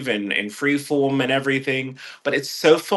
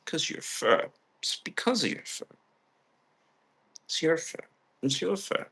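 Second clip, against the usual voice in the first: whispering; 3 semitones higher, 11 dB quieter than usual. Real, not acted. Whispering to a pet.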